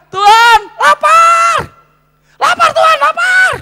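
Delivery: very high, loud, drawn-out hungry wail; hammed-up desperate shouting; acted yelling. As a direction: scream to heaven as a mock starving believer. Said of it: "saudara masih teriak kepada Tuhan".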